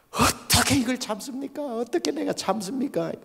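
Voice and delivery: high, pleading wail; desperate protest. Retold or imitated. Pastor protesting to God that he cannot hold back, high pleading wail. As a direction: beg heaven in disbelief, voice up high.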